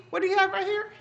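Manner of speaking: ordinary delivery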